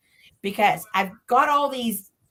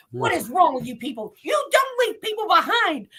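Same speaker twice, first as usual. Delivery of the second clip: higher, indignant telling-off; angry scolding; 7 semitones higher than usual. Retold or imitated. Guide berating the tour group who abandoned her, angry. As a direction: scold them for running off. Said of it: "I'm pissed at this point".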